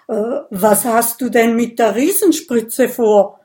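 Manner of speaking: loud, uneasy question; hesitate, then ask in alarm; stammering alarm. Acted. Dragon seeing the giant syringe, asking in alarm. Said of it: "stottert er".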